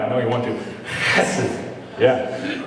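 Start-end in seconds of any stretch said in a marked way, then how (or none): 0.9-1.6 Hesed said on a laugh, the h hacked from the throat; Hesed with a rasping throat-clearing h; throaty hesed. Shown as a demonstration. Inviting the congregation to try it, Hesed with a laughing hacking h. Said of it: "Go ahead, give it a try".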